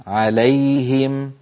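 slow recited عليهم with ي and ه both clear; clear ya before ha. Shown as a demonstration. Teacher showing izhar in عليهم. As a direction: stretch عليهم slowly. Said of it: "الياء عند الهاء في عليهم".

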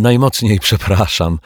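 ordinary delivery, nothing marked

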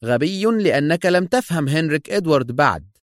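high-pitched angry rebuke. A narrator's reading. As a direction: scold the subordinate heatedly. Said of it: "وهو يستطرد في غضب".

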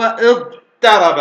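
very slow اضطرب as iḍ-taraba, light t; original ta pronunciation. Shown as a demonstration. Teacher answers own question, اضطرب with light t. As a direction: stretch اضطرب, keeping a plain t after ḍ. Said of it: "هذا هو الأصل تاء".

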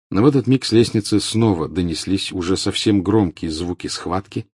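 usual speaking voice

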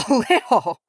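high, laughing echo in disbelief; choked laughter. A narrator's reading. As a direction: choke the word out through laughter. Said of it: "Ori choked".

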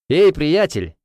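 loud call across the room; shouting. Acted. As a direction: call out loudly. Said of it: "кричу я".

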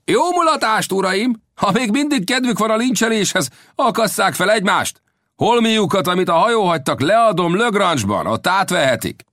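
high, mocking farewell call; gleefully scornful shout, pitched well up; shouting across to shore. A narrator's reading. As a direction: taunt them from a distance. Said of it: "átkiáltott a partra".

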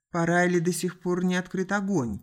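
ordinary delivery, nothing marked

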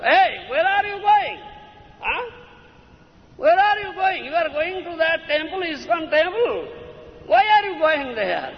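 mock-demand where he's going; scolding father. Father scolding the son for leaving home, mock-stern. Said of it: "family men create impediment".

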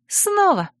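high, squeaky snort of a reply; chirpy girl. Acted. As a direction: chirp it playfully. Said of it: "хмыкнула Царья".

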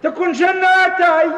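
high, loud shocked cry; shocked exclamation. Acted. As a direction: blurt it out in disbelief. Uncle shocked at news.

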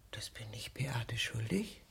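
unvoiced, secret inner question; whispered thought. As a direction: whisper it to yourself. (geflüsterter innerer Gedanke)

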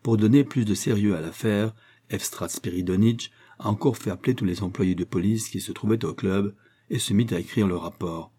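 plain reading voice

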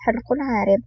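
plain reading voice

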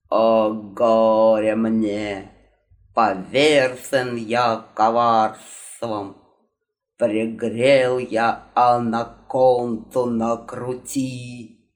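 slow, low theatrical lament; dramatic ghost lament. Acted. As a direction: declaim it like a tragic ghost. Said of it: "Продолжил диктовать Гарри".

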